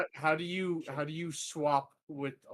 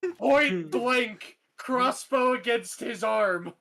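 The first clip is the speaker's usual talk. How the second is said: slow, loud, higher threat; menacing; 8 semitones higher, 5 dB louder, slower than usual. Acted. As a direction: spell out the threat slowly. Threatening to shoot ally.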